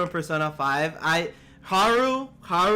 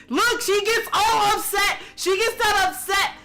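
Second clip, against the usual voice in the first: anguished squeal; 13 semitones higher than usual. Real, not acted. Upset over friend-zoning Haru.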